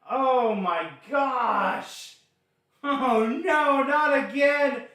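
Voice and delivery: high shouted alarm at the crash; motorsport commentator. Real, not acted. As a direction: yell it out as the wreck unfolds. Crash during live race commentary.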